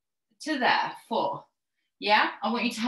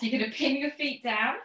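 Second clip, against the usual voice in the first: ordinary talk, nothing marked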